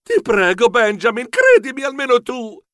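imploring, pitch rising; pleading. A narrator's reading. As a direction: beg to be believed. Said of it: "Io lo supplicai".

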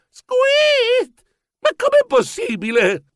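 high squeak, then incredulous question; astonished squeal. Acted. Grandson hearing he qualified, squealing in astonishment. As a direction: squeal in disbelief, then ask how.

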